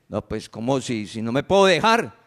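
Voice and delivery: a hint of a wife protesting; imitating a wife. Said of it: "Entonces la mujer dice".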